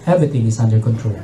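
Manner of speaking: steady the passengers with a low calm voice; calm captain. Acted. Captain calming panicking passengers, low and composed.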